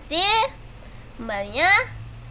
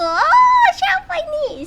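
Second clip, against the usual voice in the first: much higher, loud gushing greeting; excited flirt; 8 dB louder, slower than usual. Acted. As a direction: swoon over the handsome driver. Flirty customer greets driver.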